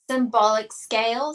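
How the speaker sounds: ordinary delivery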